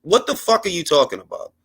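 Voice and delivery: ordinary talk, nothing marked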